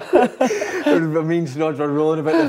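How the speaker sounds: cracking up at the memory; laughing hard. Real, not acted. Laughing at prank punchline.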